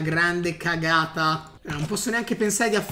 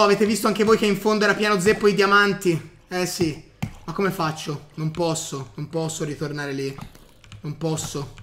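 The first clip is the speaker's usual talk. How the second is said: ordinary delivery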